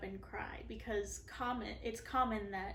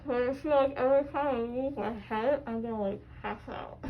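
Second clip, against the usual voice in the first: groggy; 9 dB louder, faster than usual. Real, not acted. Loopy from laughing gas.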